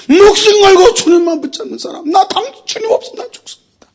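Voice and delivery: high, pleading cry; impassioned cry. Real, not acted. Preacher urging the congregation, an impassioned cry. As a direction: cry it out with passion. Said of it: "impassioned preaching".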